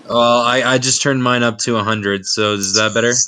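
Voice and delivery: loud and close on the mic; mic turned up. Real, not acted. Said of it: "Yeah, man".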